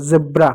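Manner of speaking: जेब्रा starting on a buzzing z; correct z pronunciation. Shown as a demonstration. Teacher repeating the corrected word, with z. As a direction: say जेब्रा as "zebra". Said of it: "ज होता है".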